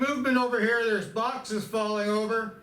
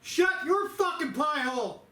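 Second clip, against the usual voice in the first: yelling inmate; 7 semitones higher than usual. Acted. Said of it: "you might hear me doing some yelling".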